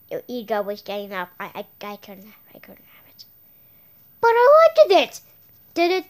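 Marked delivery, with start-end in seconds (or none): 1.4-3.2 unvoiced hushed confession; near-silent admission; whispered. Real, not acted. Scared confession. 4.2-5.2 higher and louder insistence; sudden loud protest; outburst. Real, not acted.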